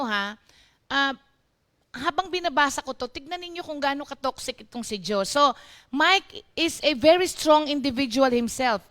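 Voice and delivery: usual speaking voice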